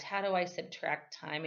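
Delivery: ordinary delivery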